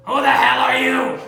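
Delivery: loud scared cry; frightened shout. Acted. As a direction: yell in fright. Scrooge startled by ghost.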